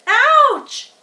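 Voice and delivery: screamed. A narrator's reading. Pig bitten on the tail, loud pained scream. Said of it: "he screamed".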